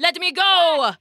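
high, protesting cry in character; panicked king. Acted. King carried off by the people, high frantic protest. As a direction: struggle against captors as the toppled tyrant.

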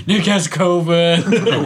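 laughing. Real, not acted. Joking amid laughter.

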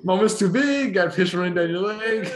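high, loud catchphrase delivery; Twitter guy impression. Guest quoting a Twitter guy at his friend, high and loud. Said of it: "what's that Twitter guy".

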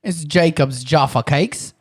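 put-on British baking-show accent; amateur British accent, presenting a cake. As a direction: read the packet in a mock British accent. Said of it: "can you read that in a British accent".

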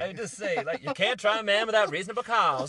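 high, loud mock Southern lawyer; hammed-up Southern courtroom oratory; Southern US accent. Teacher reading Mockingbird to students, loud Southern accent. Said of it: "no Southern accent".

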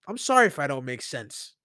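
normal talking voice

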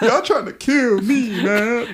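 slow, high, pained groan; mock anguish. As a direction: draw it out like you're wounded.

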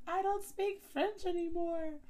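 high, loud, slow mock wail; exasperated outburst. French student giving up after the teacher's corrections, exasperated. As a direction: draw it out loudly in dramatic despair.

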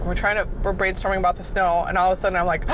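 normal talking voice

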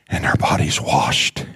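hushed reading. Real, not acted. Reading scripture, fading out.

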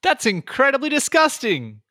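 high, loud mock outrage; appalled disgust. [name] reacts as Heidel spits on the ground, loud appalled disgust.

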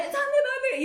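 very high, comic panic; flustered, laughing reenactment; mock frantic searching. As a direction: squeak it in hammed-up alarm. Replays her frantic searching, laughing.

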